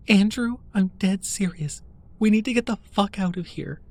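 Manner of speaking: high, shaking with fear; barely holding it together; trembling voice. Acted. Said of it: "in a trembling voice".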